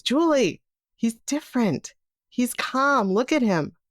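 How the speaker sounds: retold wonder, lifted in pitch; marveling husband. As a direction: voice a husband delighted by his new baby. Husband marvels at newborn son.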